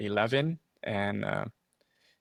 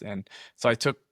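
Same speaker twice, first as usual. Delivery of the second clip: ordinary delivery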